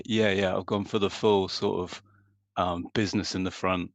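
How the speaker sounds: usual speaking voice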